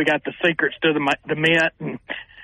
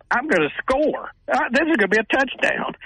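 retold excitement; 10 semitones higher than usual. Storyteller recalling his thought on an open sweep, excited. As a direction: relive the thrill in a raised pitch.

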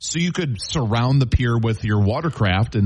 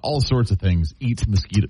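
usual speaking voice

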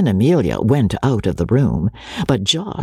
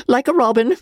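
high-pitched fussy gentleman with silly chuckles; pompous invalid tickled by his own story; giggling, boastful Joseph; 10 semitones higher than usual. Acted. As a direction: ramble on, climbing into squeaky self-amused giggles. Joseph rambles and giggles to Rebecca.

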